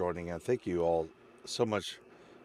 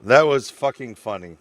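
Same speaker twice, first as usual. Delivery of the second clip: louder and higher, belting it out; loud repeat; 5 semitones higher, 13 dB louder than usual. Real, not acted. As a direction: shout it back at the chat, delighted. Repeats it louder, still laughing.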